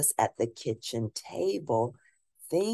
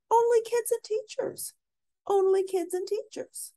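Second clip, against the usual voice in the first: put-on much higher, sing-song kid rule; high child voice. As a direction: chant it like a bossy little kid.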